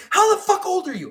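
high-pitched mock indignant demand; imitated outburst. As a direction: demand it in the customer's outraged high voice. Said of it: "lost her shit again".

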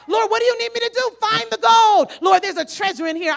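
rally the crowd to find gold; preaching at full force. Acted. Preacher exhorting the congregation, shouted preaching. Sermon climax.

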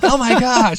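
high, loud panicky coyote; scared coyote. Retold or imitated. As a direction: voice the fleeing coyotes in alarm. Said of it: "looking over their shoulders like".

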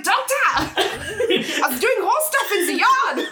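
high, slow, hammed-up German accent; mock German accent. Imitating the horse girl to her doctor, in a mock German accent. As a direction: stretch it out in a silly German accent. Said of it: "I only have one European accent".

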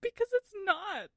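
high, insistent rebuttal; exasperated. Real, not acted. Co-host insists cereal isn't soup, exasperated. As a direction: shut the argument down, worked up. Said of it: "I'm so mad".

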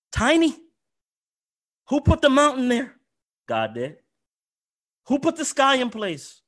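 rising rhetorical cry. Real, not acted.

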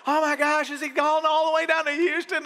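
high, loud imitation of panicked worriers; mock freaking out. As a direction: exaggerate the alarm at a son's long trip. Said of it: "some people freaking out going".

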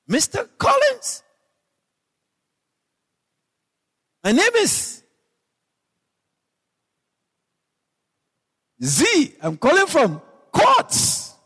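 put-on high, slow young lady's voice; imitating the caller. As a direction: mimic a polite saleswoman on the phone. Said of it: "One of them called me and said".

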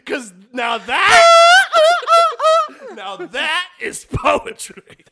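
loud, slow, laughing declaration; hammed-up loud poetry praise; mock grand proclamation. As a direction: proclaim it like a ham poet. Overblown declaration.

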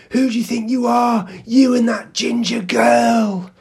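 high, loud, aggressive yell, dragged out at the end; imitated angry shout. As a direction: mimic a stranger picking a fight. Retelling stranger shouting again.

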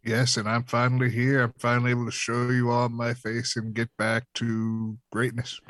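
usual speaking voice

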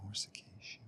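whispering. Real, not acted. Trying the word to himself.

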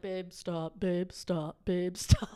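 low, soft, repeated urging; subdued boyfriend plea. Retold or imitated. Boyfriend to ranting girlfriend, low and subdued. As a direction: imitate a boyfriend quietly trying to stop her. Voices the embarrassed boyfriend pleading.

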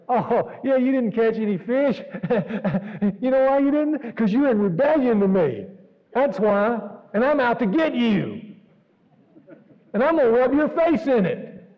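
high, loud, hammed-up scolding; mock harsh accuser. Preacher mocking a wrathful Jesus scolding the fishermen, loud and high. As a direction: play a vindictive god out to punish. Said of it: "No, that's not what he said".

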